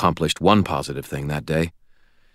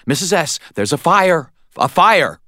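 raised, loud, alarmed voice; urgent warning; 12 semitones higher, 4 dB louder than usual. A narrator's reading. Harry at gunpoint warns Missus S of fire, urgent alarm. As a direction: press the warning on her urgently.

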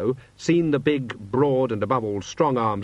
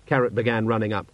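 ordinary delivery, nothing marked

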